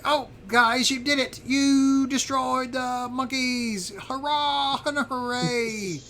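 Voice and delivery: high, drawn-out squeaky cheer; bouncy high-pitched creature celebrating; excited little creatures. Pretend. Little creatures cheering the heroes' victory, excited high voice. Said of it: "they're all excited, uh, jumping and bouncing".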